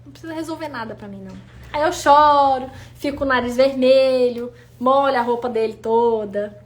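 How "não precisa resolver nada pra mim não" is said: hushed, almost breathless plea; whispered ask to just let her cry; soft, gentle request. Retold or imitated. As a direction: ask quietly for a shoulder to cry on. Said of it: "eu falo".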